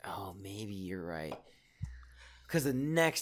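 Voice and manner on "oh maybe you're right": slow unvoiced whisper. Real, not acted. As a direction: concede it under your breath. Aside, conceding quietly.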